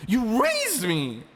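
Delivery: high, loud, slow accusing cry; a girl's outraged cry; furious accusation. Acted. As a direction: hurl it at him. Ani confronts Ivan, furious.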